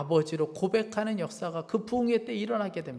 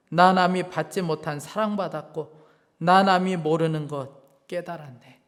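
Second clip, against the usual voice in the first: poem recitation; 6 dB louder than usual. Acted. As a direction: read the poem like a testimony.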